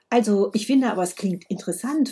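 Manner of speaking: ordinary delivery, nothing marked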